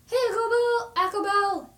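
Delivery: high, loud sing-song hello; bright high hello; cheery greeting. Real, not acted. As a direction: call out to a friend in chat. Greeting a viewer by name.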